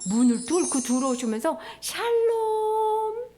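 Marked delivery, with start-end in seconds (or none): 1.8-3.3 high, long-held single note; Jesus's greeting. Retold or imitated. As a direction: intone the greeting slowly, stretched out. Jesus appears and greets disciples.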